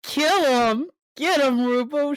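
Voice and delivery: excited cheering. Real, not acted. Player cheering on ally's kill.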